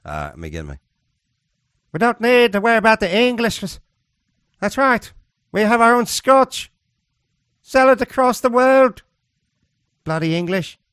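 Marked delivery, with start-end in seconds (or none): 1.9-10.8 high, loud, hammed-up Scottish accent; badly faked Scottish accent, pitched way up; mock Scottish accent. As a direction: play a proud Scot in a put-on accent. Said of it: "I can't do a Scottish accent".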